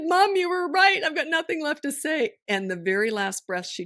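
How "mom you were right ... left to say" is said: much higher and louder, holding back tears; choking up. Real, not acted. Telling her mother's last moments, voice choking up. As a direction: fight the tears on these words. I'm trying not to cry.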